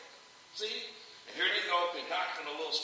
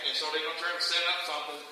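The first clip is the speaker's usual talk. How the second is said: ordinary talk, nothing marked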